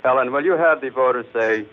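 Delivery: ordinary talk, nothing marked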